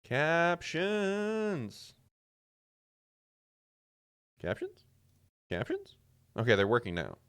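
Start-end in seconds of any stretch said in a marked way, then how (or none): 0.0-1.9 Captions said flat with a falling end; a level test call of Captions. Shown as a demonstration. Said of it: "Wait, captions?". 4.4-6.0 Captions? squeaked very high with a rising end; a tiny high-pitched Captions?; squeaky test call. Shown as a demonstration. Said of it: "Wait, captions?".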